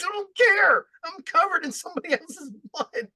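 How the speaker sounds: frantic high-pitched mock panic about going to jail; panicked yell. Panicking about jail, blood-soaked.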